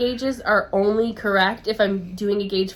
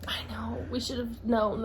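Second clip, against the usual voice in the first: whispered; 10 dB quieter than usual. Real, not acted. Hushed aside to co-host.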